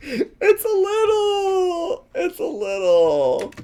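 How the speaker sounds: high, slow wail of laughter; laughing so hard it breaks into a squeal; laugh-crying. Real, not acted. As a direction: cry the words through helpless laughter. Laughing at the Lucky Charms.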